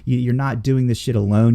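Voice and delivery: ordinary delivery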